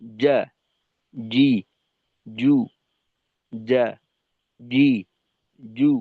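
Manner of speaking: যা জি জু without a puff of air; airless letter demo. Shown as a demonstration. Teacher testing জিম against the back of the hand. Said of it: "হাতের উল্টা পিঠ নিয়ে".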